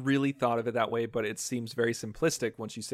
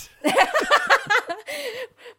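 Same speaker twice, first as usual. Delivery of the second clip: loud laugh; 5 dB louder than usual. Real, not acted. Laughs at own retraction.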